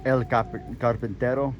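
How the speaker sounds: Carpintero with a rolled Spanish R; a touch of Spanish accent on Carpintero. Shown as a demonstration. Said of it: "is how you would say that with a Spanish accent".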